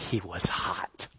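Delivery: dropped to a near whisper; hushed emphasis. Real, not acted. Preacher tells congregation how mad the boss was, whispered. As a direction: whisper it low for dramatic weight.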